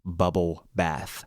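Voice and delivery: "bubble bath" dragged out very slowly; slow careful bubble. Real, not acted. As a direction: crawl through "bubble bath" so it comes out right. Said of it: "It's hard to say".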